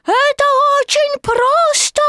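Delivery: put-on very high, loud child's call; squeaky shout. A narrator's reading. Baby Roo urging Tigger to jump, high squeaky shout. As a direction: squeal it out as an eager cheer. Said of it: "крикнул он".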